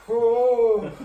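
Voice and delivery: drawn-out hammy "ohhh"; mock groan. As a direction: imitate a friend about to faint, moaning loudly. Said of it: "all of a sudden he goes".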